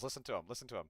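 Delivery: usual speaking voice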